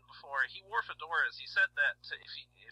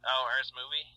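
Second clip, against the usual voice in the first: ordinary delivery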